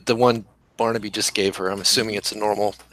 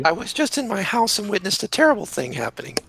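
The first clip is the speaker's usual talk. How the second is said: Celia; 10 semitones higher than usual. Acted. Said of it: "I'm going to ask Celia".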